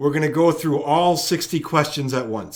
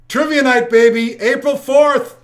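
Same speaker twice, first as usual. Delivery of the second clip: loud, high-pitched hype shout; hyped announcing; 10 semitones higher, 7 dB louder than usual. Real, not acted. Host launching trivia night for viewers, hyped shout. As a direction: belt out the show opener with big energy.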